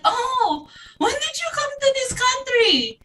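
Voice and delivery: high mock Filipino accent, teasing. Mocking Filipinos who ask when she came here, in a high accent. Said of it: "they ask me in an accent".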